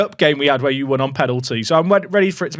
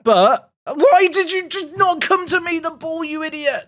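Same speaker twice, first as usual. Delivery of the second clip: exasperated scolding; 12 semitones higher than usual. Real, not acted. Scolding her player on screen.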